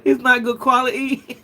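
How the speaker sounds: put-on high British accent through laughter; mock British accent. Streamer joking around with a UK viewer, giggly mock British accent. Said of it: "that accent".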